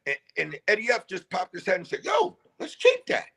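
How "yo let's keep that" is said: excited. Retold or imitated. [name] excited in booth.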